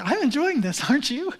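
sing-song cheerful pitch; show of happiness. Acted. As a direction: put on a cheery front for a passer-by. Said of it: "make sure that I look happy".

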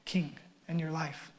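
hushed whisper; soft searching whisper; whispered question. Acted. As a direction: lean in and whisper it.

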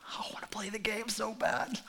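soft whisper, torn and eager; whispered kid. Retold or imitated. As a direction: whisper it like a tempted child. Kids torn by jellyfish game.

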